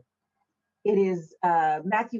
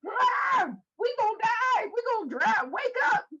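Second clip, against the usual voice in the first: mock panic. Mock panic of disciples in boat.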